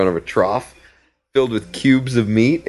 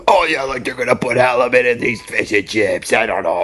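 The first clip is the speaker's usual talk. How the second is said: mocking Manny; 4 semitones higher than usual. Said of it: "he's like".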